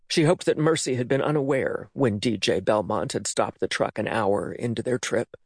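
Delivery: usual speaking voice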